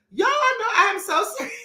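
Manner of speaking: laughing through the words. Real, not acted. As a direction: giggle while insisting. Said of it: "I'm laughing".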